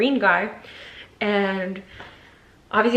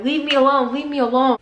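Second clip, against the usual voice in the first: higher-pitched retold plea to back off; distressed protest; 5 semitones higher, 4 dB louder than usual. Fighting him for the iPad, distressed protest. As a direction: fend him off, voice raised in pitch.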